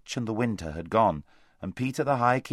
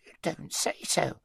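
wheezy hedgehog; 5 semitones higher than usual. A narrator's reading. Hedgehog voice, slow.

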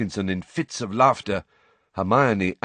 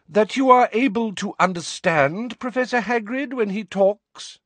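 ringing voice; 14 semitones higher, 3 dB louder than usual. A narrator's reading. Umbridge ringing out to Pansy, prim and sweet. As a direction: stretch it out, sweet and carrying. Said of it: "in a ringing voice".

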